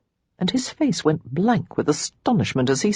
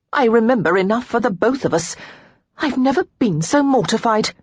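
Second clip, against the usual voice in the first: flustered protest; 6 semitones higher, 3 dB louder than usual. A narrator's reading.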